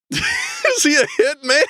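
high, slow, laughing question; laughing incredulity. Real, not acted. Listener guessing at his job, laughing in disbelief. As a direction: blurt it through laughter in disbelief.

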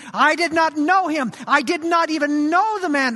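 an accused man angrily shouting he never knew him; vehement denial. Retold or imitated. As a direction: spit out the denial hard and loud. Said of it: "with growing vehemence, growing venom".

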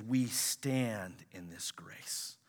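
slow, breathless whisper; hushed whisper. Real, not acted. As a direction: lean in and whisper it slowly. Whispered scripture line in sermon.

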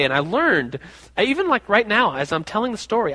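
ordinary delivery

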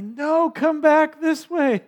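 very high, loud, drawn-out mock yell; imitated nurse's scream. Nurse screaming at new dad to return, high imitated yell. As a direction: holler it slowly like a nurse calling someone back. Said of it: "screaming at me".

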